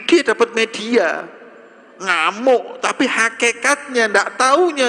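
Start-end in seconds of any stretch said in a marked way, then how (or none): none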